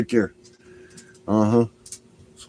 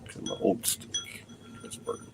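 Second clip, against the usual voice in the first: whispered aside; 3 semitones lower, 15 dB quieter than usual. Real, not acted.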